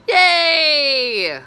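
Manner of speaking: put-on loud, high kid-like yay; voicing the son's delight; mock cheer. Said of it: "son very happy".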